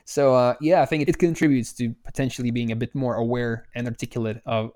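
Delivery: usual speaking voice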